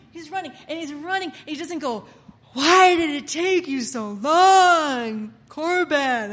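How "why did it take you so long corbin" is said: loud, drawn-out call. Acted. Running father greets the one who returns, calling out. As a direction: stretch the words out, calling loudly.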